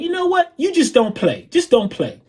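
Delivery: high mock dismissal, starting highest. Singer telling the drummer to stop, imitated high dismissal. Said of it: "he turns, he's like".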